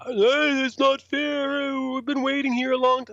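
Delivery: high, drawn-out mock whine; whining complainers. Waiting photographers complain as band boards first, high whine. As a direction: imitate people moaning about being skipped.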